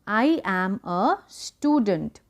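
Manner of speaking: very slow, careful I am a student; slow classroom modelling; slow model sentence. Shown as a demonstration. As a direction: spell out each word for learners. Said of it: "मैं एक विद्यार्थी हूँ".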